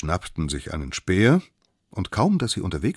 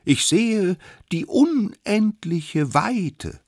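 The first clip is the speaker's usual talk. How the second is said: high, loud, drawn-out call; lookout's proclamation; 6 dB louder, much slower than usual. Acted. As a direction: announce the view slowly from up high. Lookout calls down from spear. (hoch und gedehnt verkündet)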